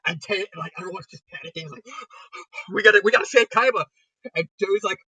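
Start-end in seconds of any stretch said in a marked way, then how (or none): 1.9-2.7 a quick unvoiced startled intake of breath; breathy panicked gasp; sharp gasp. 2.7-3.8 louder, hurried call to action; rally the group in a rush; hammed-up urgency; urgent mock plea. Heroes urgently rally to save Kaiba.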